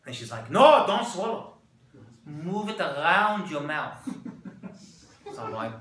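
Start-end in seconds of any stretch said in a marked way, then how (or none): 0.5-4.0 put-on slow Israeli-accented warning, loud at first; drawn-out instructions in a put-on Israeli accent. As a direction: play the Israeli guide halting a swallow. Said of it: "Here's my Israeli accent".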